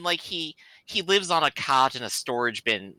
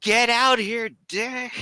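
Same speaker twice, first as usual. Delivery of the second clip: high-pitched shout at a closed door; loud indoor Wookiee; 11 semitones higher, much slower than usual. Acted. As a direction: summon him in a high Wookiee holler. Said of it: "use my loud indoor Wookiee voice".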